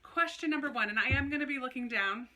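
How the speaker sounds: usual speaking voice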